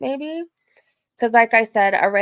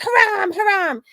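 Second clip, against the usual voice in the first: high, raised mimicked shout; retold mock shout; 11 semitones higher, 5 dB louder than usual. Catcaller to women after his come-on, high mock shout. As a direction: imitate him calling out, pitched way up. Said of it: "like, shout".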